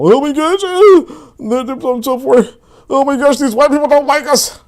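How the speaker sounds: high, whiny mock panic.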